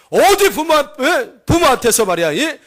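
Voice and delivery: high, loud opening burst; acted angry reprimand; furious husband. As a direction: tear into your wife for talking back. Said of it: "그러면서 그냥 손찌검을 확 한 겁니다".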